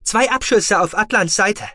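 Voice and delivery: high, loud, urgent report; female officer's voice. A narrator's reading. Female tracking officer reports battle losses, high and urgent.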